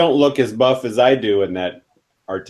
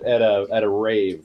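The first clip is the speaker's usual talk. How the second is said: ordinary talk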